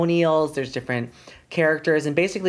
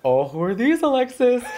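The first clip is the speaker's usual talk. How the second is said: mock sock-puppet voice; 7 semitones higher than usual. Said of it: "she goes".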